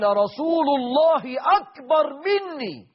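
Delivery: high, emphatic and slow; preacher voicing the uncle's reverent answer; emphatic exclamation.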